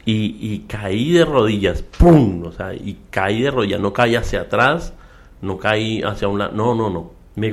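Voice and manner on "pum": loud, sudden "pum"; one loud burst mimicking the impact; imitated thud. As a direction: punch out the sound of a falling body. Imitates the thud of his fall.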